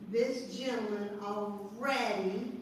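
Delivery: ordinary talk, nothing marked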